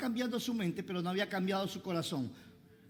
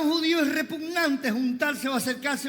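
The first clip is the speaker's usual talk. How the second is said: loud, raised pulpit declamation; full-force preaching; 5 semitones higher, 6 dB louder than usual. Acted. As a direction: proclaim the apostle's speech to the crowd.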